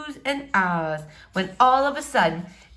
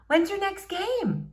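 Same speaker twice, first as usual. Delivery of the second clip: a hint of a higher girl's voice; lightly voiced girl; 12 semitones higher than usual. A narrator's reading. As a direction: ask sweetly as the girl. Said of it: "she asks".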